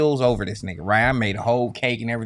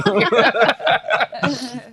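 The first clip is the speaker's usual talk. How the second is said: real laugh, pitched up; high laugh; 10 semitones higher, 4 dB louder than usual. Real, not acted. Laughs after nine years remark.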